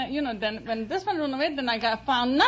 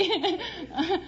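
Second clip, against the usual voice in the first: bursts into a high giggle; shy giggle; 6 semitones higher than usual. Real, not acted. Giggling to the quiz host after her answer.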